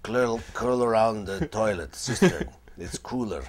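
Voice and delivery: put-on slow, loud very old man voice; Old World Grandpa. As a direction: croak it out like an ancient grandpa. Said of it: "this character called Old World Grandpa, who's like, very old man".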